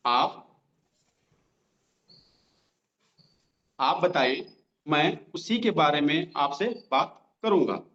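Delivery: drawn out, every word spaced with pauses; slow dictation. Shown as a demonstration. Trainer dictating the reply line for notes, slowly. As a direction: dictate it slowly for note-taking. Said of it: "slow slow बोल रहा हूँ".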